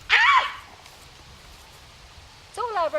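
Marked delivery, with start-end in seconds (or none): 0.1-0.6 very high, loud yelp; sudden shout. Shown as a demonstration. Trainer shouting to startle the biting dog, high and loud. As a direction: shout then stomp away. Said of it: "I'm gonna shout again".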